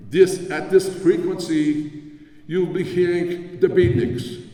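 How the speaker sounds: mock thick German accent, slow and emphatic. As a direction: draw the words out like an old German tuner with a thick accent. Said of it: "with a very thick German accent said".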